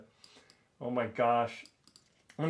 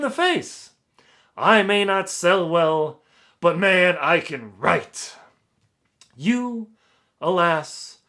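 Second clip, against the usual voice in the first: louder, higher, slow hammed-up pompous English accent; 4 semitones higher, 10 dB louder, slower than usual. Author reading the reviewer's boasts, pompous English accent. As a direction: boom it out like a grandiose English snob. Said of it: "pompous English accent".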